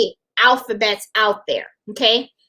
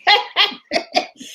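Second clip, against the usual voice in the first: giggle; 8 semitones higher than usual. Real, not acted. Laughs at her sangria.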